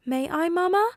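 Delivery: put-on high child voice asking; a small boy tugging at his mother; pleading little boy. A narrator's reading. Son asks mother if he may go, pleading child.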